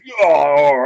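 loud, drawn-out monster bellow; other monster head. Acted. Second ettin head agreeing to attack, loud roar. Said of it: "And the other says".

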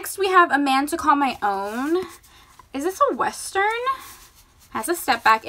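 ordinary talk, nothing marked

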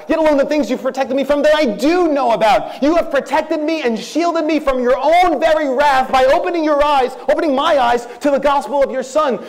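high-pitched, fervent prayer aloud; impassioned preaching. Acted. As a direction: preach it high, urging them to make it their own.

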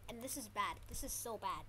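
ordinary delivery

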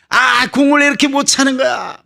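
high-pitched exasperated complaint; acted soccer-fan indignation; worked-up fan. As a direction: berate the players like an agitated TV viewer. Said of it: "흥분을 하죠".

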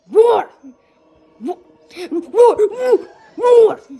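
high, loud bursts of disbelief; shocked shouting. Real, not acted. Player baffled by the game, shocked high shouts. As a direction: fire out one stunned yell after another.

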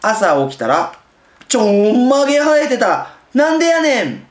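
big loud picture-book reading; read loudly. Shown as a demonstration. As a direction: belt the punchline out for the kids. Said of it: "これ声を大きく言ってるわけです。".

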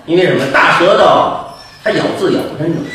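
normal talking voice

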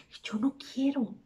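hushed, near-whispered admission; soft, secretive refusal; confiding reluctance. Acted. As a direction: drop to a whisper, as if confessing. Confiding reluctance about daughter moving in.

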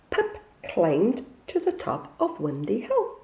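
ordinary delivery

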